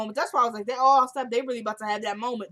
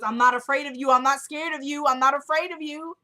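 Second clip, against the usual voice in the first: loud, higher-pitched mock defiance; defiant mother; 5 semitones higher, 6 dB louder than usual. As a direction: act out a mother standing her ground, louder and higher. Retold mother standing up to son.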